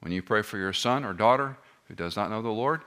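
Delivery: normal talking voice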